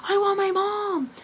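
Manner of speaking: high wailing cry for mom; mock yell far up in pitch; retold yell. Panicking and calling for mom, retold high-pitched yell. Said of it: "and so I yell, like".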